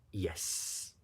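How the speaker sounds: sheepish whispered "yes"; whispered confession. Retold or imitated. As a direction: admit it under the breath. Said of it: "And I said".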